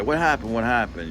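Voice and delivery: high-pitched, hurried-sounding questioning; imitated alarmed neighbor. Neighbor outside asking what happened, imitated alarm. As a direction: mimic a neighbor calling out in alarm. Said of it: "then my neighbor's fucking outside".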